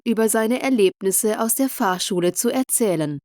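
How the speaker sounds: plain reading voice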